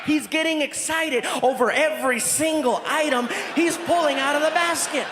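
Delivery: normal talking voice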